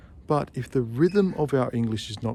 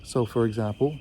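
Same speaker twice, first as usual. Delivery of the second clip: ordinary talk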